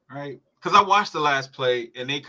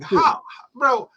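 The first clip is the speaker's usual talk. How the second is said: high, loud disbelieving cry; can't believe what he just saw; incredulous; 10 semitones higher, 4 dB louder than usual. Retold or imitated. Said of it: "I'm like".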